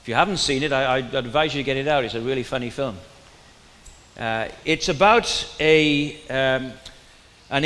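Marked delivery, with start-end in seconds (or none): none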